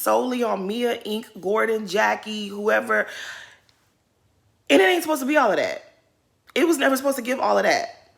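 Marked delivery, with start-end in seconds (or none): 4.7-8.1 heated rant. Real, not acted. Heated rant about Mia.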